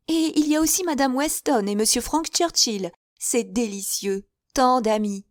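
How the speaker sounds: high, eager, fluttering spinster voice; chattering Miss Bates. A narrator's reading. Miss Bates gushing to her guests, high and eager.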